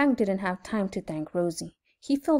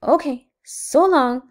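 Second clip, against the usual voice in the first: high, loud, drawn-out goodbye; slow, lilting happy send-off; cheerful; 5 semitones higher, 7 dB louder than usual. A narrator's reading. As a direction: sing out a cheerful farewell. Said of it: "said Rosie cheerfully".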